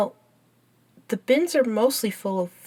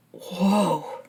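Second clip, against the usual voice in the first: drawn-out, falling whoa; stunned exclamation; taken aback. Acted. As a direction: react in startled wonder. Startled by the thermometer reading.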